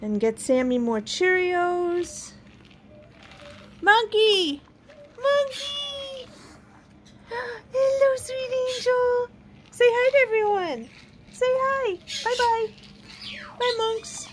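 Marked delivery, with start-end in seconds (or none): 3.8-14.3 high cooing to a pet; excited pet talk. Real, not acted. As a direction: coo high and sweet to the pet.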